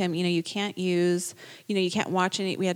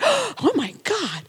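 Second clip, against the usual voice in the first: high, slow, stunned "oh my God"; shocked gasp; 12 semitones higher, 4 dB louder, slower than usual. Retold or imitated. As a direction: replay the moment of shock, drawn out.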